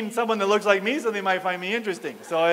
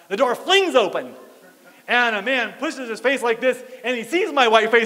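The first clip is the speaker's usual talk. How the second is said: ordinary delivery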